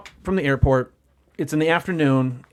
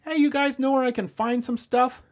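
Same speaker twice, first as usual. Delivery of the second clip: much higher friendly call; calling out to kids; 10 semitones higher than usual. Retold or imitated. Client leaning out to kids on bikes, high calling out. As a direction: call out brightly from the car window. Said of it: "and he goes".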